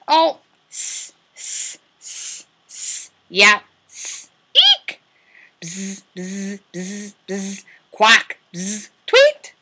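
animal sounds. Acted. Teacher voicing snake and bee for toddlers. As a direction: hiss like a snake, buzz like a bee.

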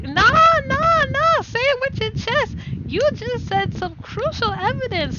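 high, eager, pouncing voice; eager pounce. Acted. Character pounces on the witness's slip, high and eager. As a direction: seize on the slip gleefully.

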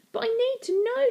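put-on high, insistent girl; urgent, high demand. A narrator's reading. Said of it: "demanded Stella".

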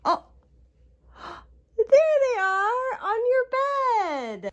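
put-on slow, high sing-song to a toddler; reading to a toddler. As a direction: draw the little one into the game. Seek-and-find game with toddler.

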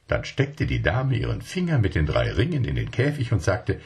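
usual speaking voice

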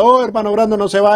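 high-pitched imitated shout, pleading; retold cry out. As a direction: call out as if after someone leaving. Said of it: "Yo estaba gritando". (grito agudo de súplica, imitado)

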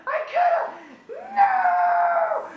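high loud anguished shout; frustrated shout. Real, not acted. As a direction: yell in frustration.

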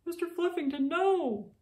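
high alarmed cry after the pet. Acted. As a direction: call out in sudden alarm. Pet mouse runs off.

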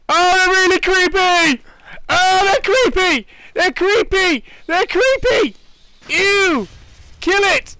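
very high, loud creeped-out squeals; high squealing disgust at spiders. Real, not acted. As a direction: shriek in horror at the spiders.

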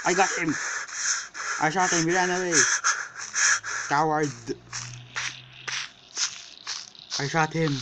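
excited triumph. Real, not acted. Player boasting after shooting Bigfoot, loud excited gloating. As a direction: crow over the hit.

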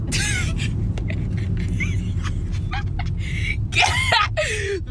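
high squealing laugh, close to tears; laughing fit. Real, not acted. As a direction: laugh until it almost turns to crying. Laughing fit about grandma.

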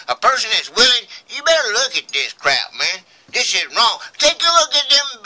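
high, loud and insistent; real indignant outburst. Real, not acted. Vlogger confronting those he blames for Lisa's death, indignant. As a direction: demand that they face the wrong. Ranting against those who took her life.

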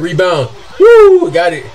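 usual speaking voice

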